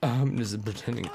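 normal talking voice

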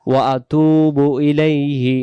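ওয়া আতুবু ইলাইহি very slowly, each sound clear; slow model recitation. Shown as a demonstration. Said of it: "এরপরে আমরা বলি". (ওয়া আতুবু ইলাইহি খুব ধীরে, প্রতিটি শব্দ স্পষ্ট)

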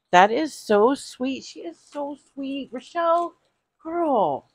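high-pitched, delighted gushing; touched gushing. Real, not acted. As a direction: gush gratefully to a friend, voice lifted high. Gushing thanks for a friend's gift.

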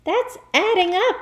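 put-on high, loud boy's protest; cried out. Boy objects to the sum, crying out. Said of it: "Wilfred cried".

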